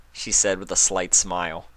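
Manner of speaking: ordinary delivery, nothing marked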